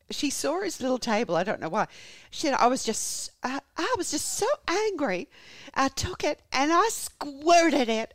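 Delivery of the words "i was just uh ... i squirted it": high, drawn-out Southern confession; hammed-up angry Southern drawl, building louder; American Southern accent. Wife confessing at home, high angry American Southern accent. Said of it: "make Lana like this".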